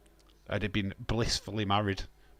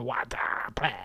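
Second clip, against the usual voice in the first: Budweiser advert impression. Streamer quoting the beloved beer advert, stretched-out catchphrase. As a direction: stretch it out wide open like the old advert. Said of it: "the advert for Budweiser, where we go".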